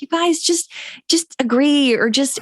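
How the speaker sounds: imitating pleading kids, high-pitched; mock-pleading, pitched well up; exasperated daughters. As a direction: whine like daughters begging the parents to stop. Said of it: "sometimes our girls are like".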